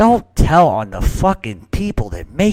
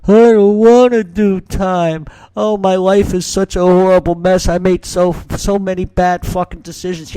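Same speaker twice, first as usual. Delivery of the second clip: mock crybaby in court; 7 semitones higher, 4 dB louder than usual. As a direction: whine it like a gangster begging the judge. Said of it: "and then cry in a court".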